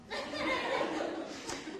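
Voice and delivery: squealing high laugh; high delighted laugh. Real, not acted. Grandma laughing at the toddler's one-word answer.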